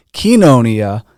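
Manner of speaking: kenonia with a KEE first syllable, loud; third heard variant. Shown as a demonstration. Listing versions he has heard, loud variant pronunciation. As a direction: say kenonia starting key, not koy. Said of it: "So I've heard".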